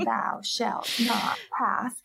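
slow, drawn-out Gandalf declaration; Gandalf command. Retold or imitated. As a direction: boom it out slowly like a wizard barring the way. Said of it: "a little like Gandalf".

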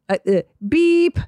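imitated intercom beep; mock beep. Imitating the help button sound.

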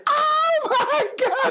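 imitated drawn-out falsetto scream; mock squeal, very high and slow, laughing; freaked-out shriek. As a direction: wail it sky-high like an astonished kid running off. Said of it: "he just sprinted out going".

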